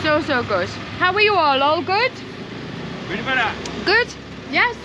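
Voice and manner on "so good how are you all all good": normal talking voice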